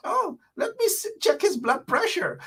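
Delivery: high, soft, fretful mocking voice with a whimper; mock fussing nurse. Mocking health workers in a hospital, high fretful nurse voice. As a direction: parody a panicky nurse cooing over a patient.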